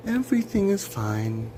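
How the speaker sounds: very high-pitched, put-on everything's fine; gasp, then squeaky-high false relief; mock cheerful reassurance. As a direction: sing out fake reassurance way up high.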